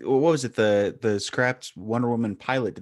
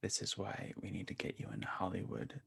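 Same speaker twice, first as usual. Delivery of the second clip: whispering; 16 dB quieter than usual. Real, not acted. Teasing aside to co-host.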